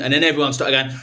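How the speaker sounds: ordinary talk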